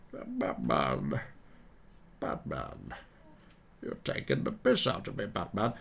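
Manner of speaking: low, quiet put-on villain voice; Bane. Villain accusing Batman of mocking him, Bane impression. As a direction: menace your enemy, softly and low. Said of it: "asked me to do Bane".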